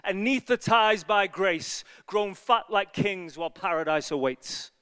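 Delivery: slow, measured poem reading; poem recitation. Acted. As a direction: recite it like a lament. Reciting his own poem.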